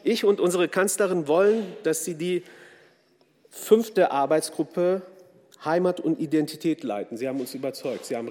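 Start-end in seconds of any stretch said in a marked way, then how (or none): none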